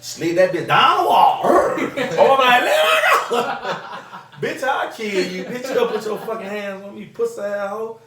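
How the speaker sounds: laughing while telling how he choked her. Real, not acted. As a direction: cackle through the brag.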